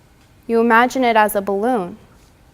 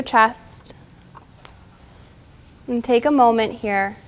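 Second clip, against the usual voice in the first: guided relaxation; 2 semitones higher, 4 dB louder than usual. Acted. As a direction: talk them gently through each breath. Leads yoga cool-down and breathing.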